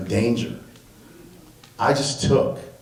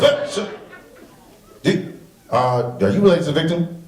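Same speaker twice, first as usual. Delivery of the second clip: imitating the sergeant; 7 semitones higher than usual. Sergeant voiced in story.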